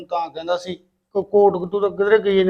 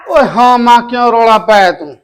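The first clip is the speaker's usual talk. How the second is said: shouting back from a distance; loud irritated reply to mother's call; loud answer; 3 semitones higher, 7 dB louder than usual. Acted. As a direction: son yelling back, why the noise. Son answers mother's call loudly.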